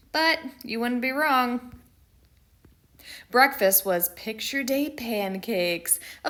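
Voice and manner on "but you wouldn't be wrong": usual speaking voice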